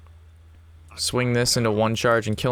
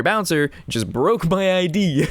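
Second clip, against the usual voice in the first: laughing; 7 semitones higher, slower than usual. Real, not acted. Retelling complaint, laughing through.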